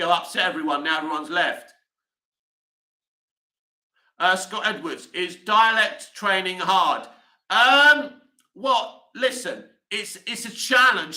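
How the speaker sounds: ordinary talk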